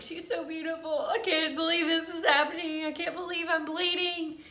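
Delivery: high-pitched tearful wail, re-enacted; acted-out crying. Patient at her ultrasound seeing her baby while bleeding, re-enacted crying. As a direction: replay a weepy, overwhelmed outburst, pitched right up. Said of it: "I'm just crying".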